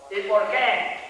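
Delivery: ordinary delivery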